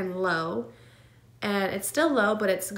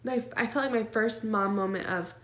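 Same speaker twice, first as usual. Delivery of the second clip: ordinary talk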